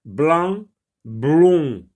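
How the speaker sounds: "Blanc" with an, "blond" with on, both loud and drawn out; contrasted nasal vowels. Shown as a demonstration. Teacher separates look-alike words, "Blanc" and "blond" with exaggerated distinct vowels. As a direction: set open an in "Blanc" against rounded on in "blond". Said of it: "Rappelez-vous, an et on".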